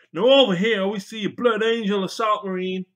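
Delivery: high, loud excited presenter; excited wildlife presenter. Shown as a demonstration. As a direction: shout it like a thrilled zoo host. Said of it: "You could do a bit about Irwin and Attenborough".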